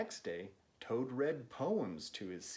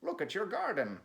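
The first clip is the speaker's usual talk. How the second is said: higher, brighter Frog pointing; Frog's voice, excited; 7 semitones higher, 4 dB louder than usual. Acted. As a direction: Frog eager to show the garden. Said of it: "said Frog".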